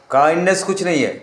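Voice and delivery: ordinary talk, nothing marked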